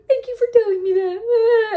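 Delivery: high, loud crying-through-words thanks; mock sobbing. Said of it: "I started crying".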